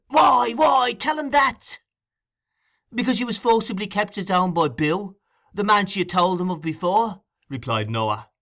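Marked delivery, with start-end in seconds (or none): 0.1-1.8 very high, loud shout; frantic demand. A narrator's reading. 2.9-7.2 lightly voiced informer, pitched up; Noah, reporting. A narrator's reading. Noah telling on Nancy, raised matter-of-fact voice.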